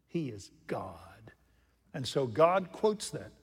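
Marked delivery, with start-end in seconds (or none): 0.1-1.3 whisper. Real, not acted. Reverent aside after quote.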